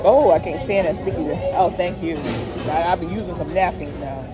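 ordinary delivery

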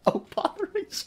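high soft comic exclamation; high, soft mock dismay. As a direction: squeak it out in mock distress. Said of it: "Oh, botheration!".

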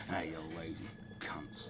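hushed, slow whispered insult; whispering. Real, not acted. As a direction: whisper it under your breath. Muttering insult at idle NPCs.

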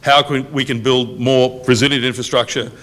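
ordinary talk

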